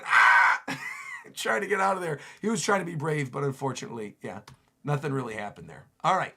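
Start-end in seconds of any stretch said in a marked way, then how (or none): 0.0-0.6 loud, hammed-up terrified scream; mock scream. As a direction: scream as if monsters are descending. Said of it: "he's simply screaming".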